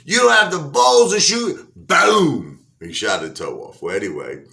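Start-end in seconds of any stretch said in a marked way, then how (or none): 0.0-1.7 high, loud mocking dare; taunting. Wife daring husband to shoot, loud high taunt. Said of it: "She was, like, taunting him". 1.8-2.7 gunshot boom. Retold or imitated. Gunshot in retold movie scene.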